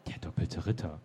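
low, soft, incredulous echo; understated, hushed incredulity; mild disbelief. A narrator's reading. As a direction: say it quietly, barely believing it. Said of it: "sagte Henriette ungläubig".

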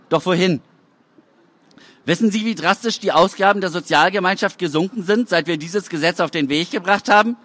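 plain reading voice

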